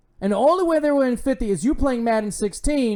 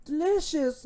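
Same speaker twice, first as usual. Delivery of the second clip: savoring mock voice; 9 semitones higher, 6 dB quieter, slower than usual. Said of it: "he's like".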